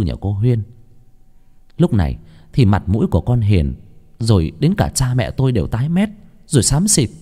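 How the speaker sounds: ordinary delivery, nothing marked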